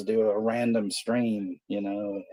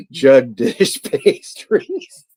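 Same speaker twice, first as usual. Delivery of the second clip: laughing; 4 semitones higher, 11 dB louder, much slower than usual. Real, not acted. Laughing at a silly name.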